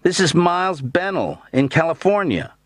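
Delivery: high-pitched long-distance announcing. A narrator's reading. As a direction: project your name down a long phone line. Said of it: "raised my voice the way people do in long-distance".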